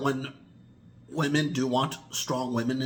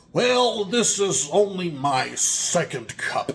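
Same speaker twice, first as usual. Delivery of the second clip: high, slow, put-on voice of someone making excuses; excuse-making coffee drinker; 6 semitones higher, 6 dB louder, slower than usual. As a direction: whine out the excuse innocently. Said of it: "make excuses like".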